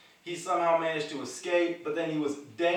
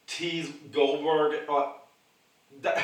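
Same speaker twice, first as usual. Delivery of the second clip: ordinary talk, nothing marked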